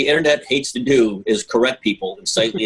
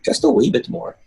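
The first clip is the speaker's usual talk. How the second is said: a higher, hammed-up Scottish lilt; mock Scottish accent; 11 semitones higher than usual. Host joking about pouring more, bad Scottish accent. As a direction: play the Scotsman begging for more, Scottish. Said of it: "I'd use my Scottish accent".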